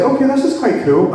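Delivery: high, impressed lift on okay; impressed reporter. Retold or imitated. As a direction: voice her sudden excitement. Journalist reacting to the angels.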